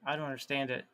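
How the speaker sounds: normal talking voice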